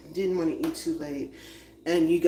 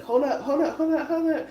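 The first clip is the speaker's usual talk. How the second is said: high, rising flustered hold up; 10 semitones higher, 4 dB louder than usual. Retold or imitated. Donna losing her footing at double dutch, high flustered hold up. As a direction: stop them, getting higher each time. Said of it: "Be like".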